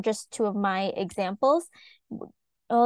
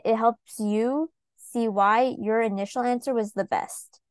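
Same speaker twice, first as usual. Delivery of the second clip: ordinary delivery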